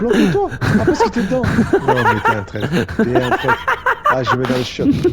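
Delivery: high, loud laughing shouts; laughing excitedly. Real, not acted. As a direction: laugh while yelling at a friend. Laughing while telling friend hide.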